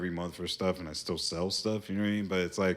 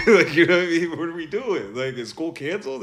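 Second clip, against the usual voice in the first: real laugh, much higher and louder; 10 semitones higher, 11 dB louder than usual. Real, not acted. Newcomer from Virginia recalls outdoor schools, laughing loud. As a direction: laugh through the question at the memory.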